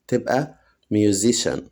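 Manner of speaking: usual speaking voice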